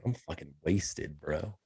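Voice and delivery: low, slow mock-drunk slur; getting drunker. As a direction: play it wasted off one snack. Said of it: "I just had a salami stick".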